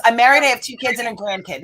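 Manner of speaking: loud, high mock exasperation; lightly hammed-up impatient answer; impatient retort. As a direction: play a driver rattling off the facts, loudly. Acting an impatient driver's reply.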